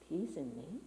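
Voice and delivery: soft rising question to yourself; hushed doubting question. Retold or imitated. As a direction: murmur the doubt inward.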